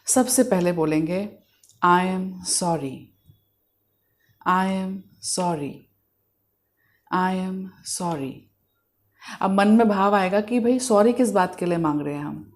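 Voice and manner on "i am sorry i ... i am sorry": slow, soft, hushed apology; meditative affirmation. Shown as a demonstration. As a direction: say it slowly and softly, pausing between repeats. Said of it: "आंखें बंद करके in the moment आके". (धीमी, शांत प्रार्थना की आवाज़)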